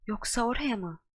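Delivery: ordinary delivery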